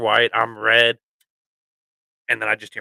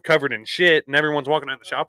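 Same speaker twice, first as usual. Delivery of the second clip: ordinary delivery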